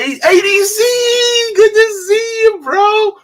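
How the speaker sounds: very high, loud, stretched-out holler; screeching happy shout-out; excited shouted greeting. Real, not acted. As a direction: holler for a friend who just arrived. Greeting a friend joining chat.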